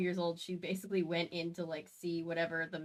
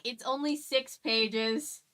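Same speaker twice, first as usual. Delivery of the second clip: ordinary delivery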